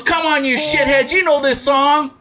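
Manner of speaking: high, exasperated performer impression; mock berating. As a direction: scold the audience from the stage. Singer berating the crowd.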